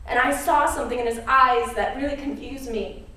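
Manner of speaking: usual speaking voice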